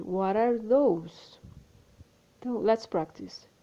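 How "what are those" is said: very slow "What are those?"; slow model question; slow demo. Shown as a demonstration. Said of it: "se dois ou mais objetos estiverem longe".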